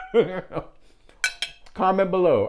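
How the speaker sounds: high laughing burst into "comment below"; real laugh while teasing viewers. Real, not acted. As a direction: crack up, then call out. Laughing at unsubscribers.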